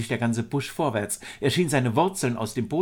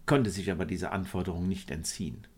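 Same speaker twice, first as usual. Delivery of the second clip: plain reading voice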